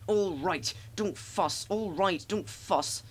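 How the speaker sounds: grumbling. A narrator's reading. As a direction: complain back at a nagging engine. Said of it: "grumbled the trucks".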